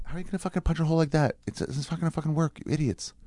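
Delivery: high, soft, peeved muttering; exasperated grumble. Real, not acted. Grumbling at whoever made the broken hole punch, exasperated.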